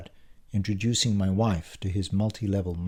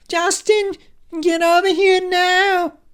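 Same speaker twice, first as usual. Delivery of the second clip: high-pitched scream for help; piercing, panicky receptionist screech; Lucy; 9 dB louder, slower than usual. A narrator's reading. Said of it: "screaming in her typically high-pitched voice".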